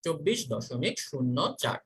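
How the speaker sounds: slow, quiet model answer. Shown as a demonstration. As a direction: say চব্বিশ দশমিক শূন্য চার slowly and softly as the answer. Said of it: "দেখি তোমাদের মুখস্থ হইল কিনা".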